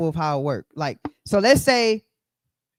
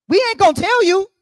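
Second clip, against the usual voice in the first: much higher, louder mock knowing tone; 12 semitones higher, 8 dB louder than usual. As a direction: pitch it way up, playful and knowing. Playful high aside.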